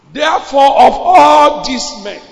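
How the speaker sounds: high, loud and slow with ALL stretched; proclaiming shout. Acted. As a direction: call it out to the whole church. Preacher proclaims Peter's words.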